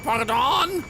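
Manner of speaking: hammed-up indignant French exclamation; offended French viewer. Streamer imitating an offended French viewer, high mock indignation. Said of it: "He's like".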